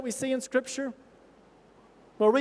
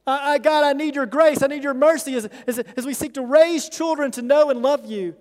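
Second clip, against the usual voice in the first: prayer; 3 semitones higher, 5 dB louder than usual. Acted. Preacher breaks into prayer.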